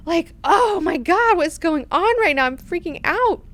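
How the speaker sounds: high-pitched, hammed-up panic; freaked out. Duncan who just tried to kill an Atreides, freaked-out panic. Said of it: "he also is freaked out".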